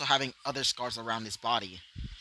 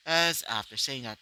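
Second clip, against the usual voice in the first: plain reading voice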